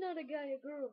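normal talking voice

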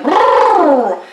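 lips buzzed in a mock motorboat; motorboat noise. Partner in bedroom motorboating, buzzing brrr noise. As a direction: blow a silly brrr noise. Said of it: "they're just like".

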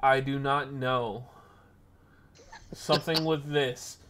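slow, deliberate deadpan retort; drawn-out, unbothered smart-aleck answer. Retold or imitated. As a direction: spell it out slowly with a straight delivery. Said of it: "I look her dead in her eye and I go".

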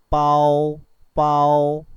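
빠오 loud and held, tense ㅃ then gliding vowels; pronunciation model. Shown as a demonstration. Teacher shows learners 빠오, loud and sustained. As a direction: project 빠오 as a long level syllable. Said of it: "이렇게 하면 되겠습니다".

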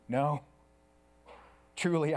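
usual speaking voice

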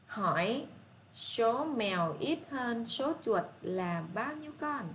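put-on slow, deliberate read of the problem for children; reading aloud slowly. Shown as a demonstration. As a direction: spell the question out word by word for the class. Teacher reads word problem aloud. (đọc đề chậm rãi cho các em)

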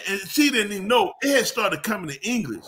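ordinary talk, nothing marked

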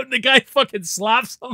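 usual speaking voice

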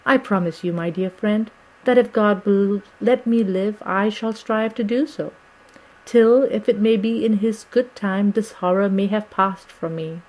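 ordinary delivery

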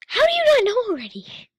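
high loud disbelieving cry; exclaiming. Real, not acted. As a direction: blurt it out in disbelief. Exclaims at the video.

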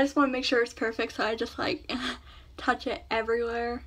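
fitting aligner mid-speech; slight lisp from trays; speaking with aligners in. Real, not acted. Seen 2: Lower tray seemingly in; top aligner pressed onto teeth while speaking.

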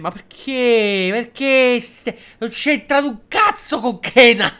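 loud, high, slow indignant rant; drawn-out, loud protest; exasperated outburst. Real, not acted. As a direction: blow up at the pointless question. Outburst at a silly game question.

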